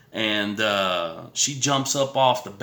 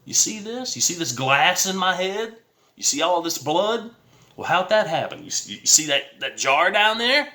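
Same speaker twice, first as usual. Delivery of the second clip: higher and louder, pointing out the proof; indignant pleading; 8 semitones higher, 3 dB louder than usual. Retold or imitated. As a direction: pitch up and demand they look at the blood. Showing wounds to police, indignant.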